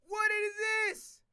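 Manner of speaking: high, loud, slow disbelief; shocked. Real, not acted. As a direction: gasp at the numbers. Shocked at contract.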